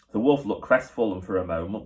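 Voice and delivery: usual speaking voice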